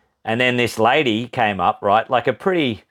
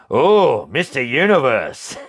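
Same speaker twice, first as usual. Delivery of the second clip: impressed; 2 semitones higher, slower than usual. Retold or imitated. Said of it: "And she's like".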